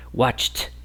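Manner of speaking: WATCHED as one syllable, "watcht"; correct T ending. Shown as a demonstration. Teacher modelling the voiceless ending, watched as "watcht". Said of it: "esto va a sonar como una t".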